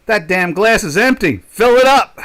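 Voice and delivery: mock irritated outburst; blunt complaint. Shown as a demonstration. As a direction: bark it like an impatient client. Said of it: "You can't say to them".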